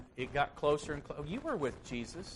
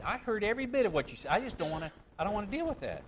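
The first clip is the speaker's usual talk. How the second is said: honest inner voice; 8 semitones higher, much faster than usual. Pretend. The inner thoughts behind a lie.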